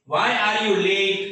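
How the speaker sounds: very slow, word-by-word classroom demo; very slow model question. Shown as a demonstration. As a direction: stretch every word for learners to copy. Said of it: "Why એટલે શા માટે". (ખૂબ ધીમો, સ્પષ્ટ પ્રશ્ન)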